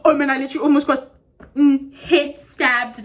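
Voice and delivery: rushed, breathless gasp of relief, then slowing down; mock panicked relief. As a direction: blurt it out as if you just escaped. Relief after the girl leaves.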